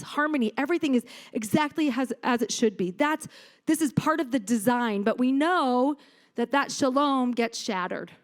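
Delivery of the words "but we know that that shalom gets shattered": normal talking voice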